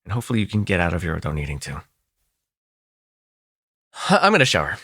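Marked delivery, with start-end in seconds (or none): none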